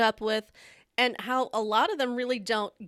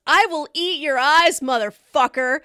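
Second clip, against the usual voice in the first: higher, much louder savage snarl; furious threat; 7 semitones higher, 12 dB louder than usual. Retold or imitated. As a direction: threaten her like you'll really do it. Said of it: "and she goes".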